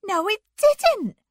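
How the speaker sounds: high, loud, slow rebuke; loud high-pitched telling-off; sharp scolding. A narrator's reading. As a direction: scold him sharply, stretching it out. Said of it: "Anne scolded sharply".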